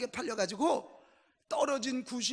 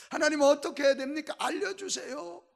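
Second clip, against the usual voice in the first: raised-pitch, insistent cry to God; pleading prayer; 7 semitones higher, 5 dB louder than usual. Retold or imitated. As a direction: imitate a farmer begging God for an answer. Farmer's prayer grows insistent.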